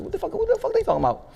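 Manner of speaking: high, stunned what-the-fuck; reliving the shock of seeing the news; shocked disbelief. Retold or imitated. Shocked watching news conference.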